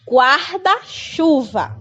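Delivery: Guarda-chuva said slowly with a strong R, "gahda"; slow model word. Shown as a demonstration. Teacher answering how the sound goes. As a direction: stretch Guarda-chuva out and push the R. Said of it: "como é que faz o som?".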